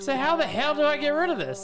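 high-pitched, worked-up complaint; mock exasperation. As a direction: wail it in comic frustration. Retells exasperation at failed uninstall.

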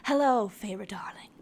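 voiceless, smooth whisper; whispered villain greeting. Acted. Dark lord greeting the bride at her wedding, whispered. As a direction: whisper it as a dramatic entrance. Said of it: "Rhysand says".

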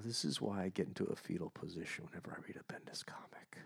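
hushed, unvoiced confession; soft defeated whisper. Real, not acted. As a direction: whisper it like curling up. Whispering despair.

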